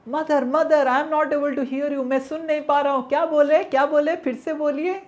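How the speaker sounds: put-on high, loud child's call; loud, high-pitched playful calling; pretending not to hear. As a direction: shout back as if hard of hearing.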